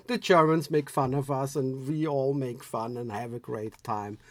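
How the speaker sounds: ordinary talk, nothing marked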